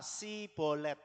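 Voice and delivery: "Sibolet" dengan S tipis biasa; bunyi S, bukan SH. Shown as a demonstration. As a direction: ucapkan "Sibolet" tanpa desis SH. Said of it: "Dan dia berkata". ("Sibolet" berawalan S polos)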